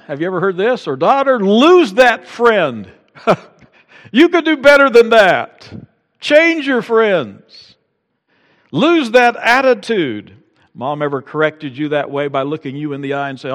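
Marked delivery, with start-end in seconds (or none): 0.9-2.9 high, loud, slow mother's order; stern mock mom. Mom voice correcting daughter. 4.1-10.3 high, loud mother's commands; stern mock mom. Mom voice correcting child.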